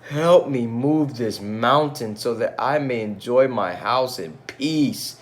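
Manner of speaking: crying out. Acted. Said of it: "cried Ming Lo".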